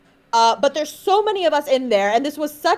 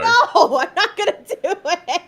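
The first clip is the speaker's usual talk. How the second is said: incredulous refusal; 6 semitones higher than usual. Real, not acted. Co-host pushed to do the accent, incredulous refusal.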